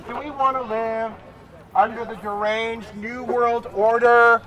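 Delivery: slow, loud rally call; rabble rouser. Real, not acted. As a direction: drag out each line to whip up the crowd. Rally speaker working the crowd.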